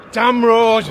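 loud, exasperated outburst; annoyed shout. Real, not acted. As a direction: shout at the noise. Annoyed at passing cars.

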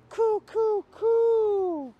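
high, hooted bird coos; pigeon cooing. Acted. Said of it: "but a gentle coo".

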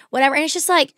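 usual speaking voice

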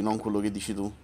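normal talking voice

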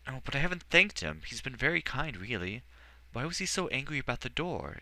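put-on a curious child pouring out questions; boy asking the wizard about the door, eager. A narrator's reading. Edward talking to Archmagister.

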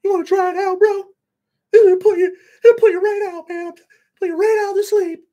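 very high, loud squeaky voice; John Powers offering pills. Retold or imitated. Imitating John Powers offering Buspirone, very high squeaky voice. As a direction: squeal it like a cartoon character. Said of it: "He's like".

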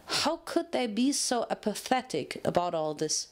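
plain reading voice

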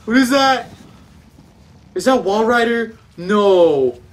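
high, loud, real alarm. Real, not acted. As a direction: panic at a sudden sighting. Spots monster in horror game.

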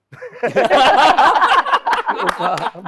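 loud, high burst of laughter; real belly laugh, well above talking voice; hearty laugh. Real, not acted. Singer cracking up while telling colleagues his story, hearty laugh. Amused reaction amid lively chat.

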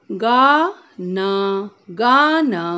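गाना with both आ drawn out slowly; stretched aa. Shown as a demonstration. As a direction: pull the आ in गाना long. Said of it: "खींच के बोलना है".

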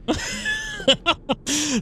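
squeaky nervous giggle; high nervous laugh. Real, not acted. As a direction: giggle through the fright. Jumpy in horror game.

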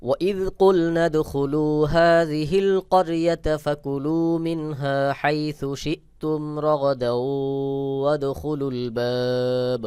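very slow, high melodic recitation; Quran recitation. Shown as a demonstration. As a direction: chant the verse, stretching each vowel. Full verse recitation at close. (ধীর সুরেলা কুরআন তেলাওয়াত)

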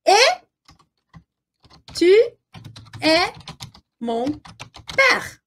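each word set apart by long pauses. Shown as a demonstration. Teacher giving Paul's line to learners, word by word. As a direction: dictate it one word at a time.

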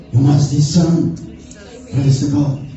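normal talking voice

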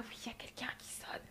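hushed whisper into the phone; scared hotel guest whispering to reception. Retold or imitated. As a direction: whisper so the intruder can't hear. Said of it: "et je parle comme ça".

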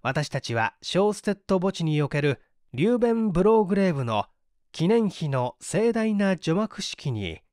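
ordinary delivery, nothing marked

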